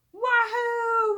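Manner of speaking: a high, very loud whoop of joy; shrieking hooray; excited whoop. Acted. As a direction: cheer like a kid who just cracked the puzzle. Children find their clue.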